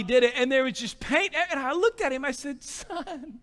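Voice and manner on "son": high, hushed, exasperated appeal; soft, strained plea to a child; pleading. Retold or imitated. Dad finding son painted in the garage, quiet pleading. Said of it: "like, pleading with you, boy".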